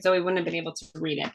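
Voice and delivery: ordinary talk, nothing marked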